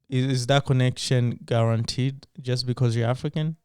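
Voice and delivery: ordinary delivery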